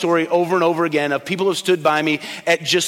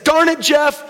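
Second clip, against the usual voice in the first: much higher, forceful burst; emphatic outburst; 9 semitones higher, 3 dB louder, slower than usual. Retold or imitated. Chairman slamming the table at the council meeting, emphatic outburst. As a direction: burst out like a fed-up old chairman. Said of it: "slammed his hand down on the table".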